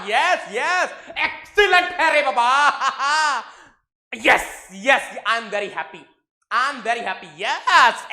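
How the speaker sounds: loud, high delighted yes yes; excited cheering. Real, not acted. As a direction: cheer the class on with a big laugh. Teacher celebrates student answers. (खुशी से चिल्लाते हुए शाबाशी)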